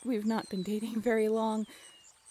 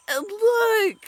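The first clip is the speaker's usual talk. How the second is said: flustered outburst; 8 semitones higher, 9 dB louder than usual. Acted. Flustered defending herself.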